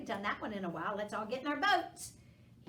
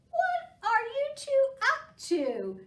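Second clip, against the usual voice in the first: high, louder mother squirrel asking; Mom Squirrel; 13 semitones higher, 10 dB louder, slower than usual. Acted. Mother squirrel checking on her two kids, high and bright. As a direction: pitch way up for the playful mother squirrel.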